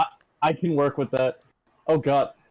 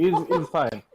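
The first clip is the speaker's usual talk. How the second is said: normal talking voice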